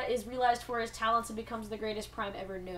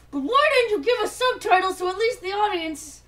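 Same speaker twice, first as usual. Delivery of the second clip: exasperated; 6 semitones higher, 6 dB louder than usual. Real, not acted. Ranting about missing subtitles.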